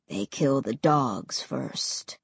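low, slow, flat voice; cold matter-of-fact, slow; deep and cold. A narrator's reading. Ray's voice.

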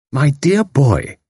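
slow, firm motherly rebuke; crisp and compelling. A narrator's reading. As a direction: take charge crisply. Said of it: "crisp and compelling".

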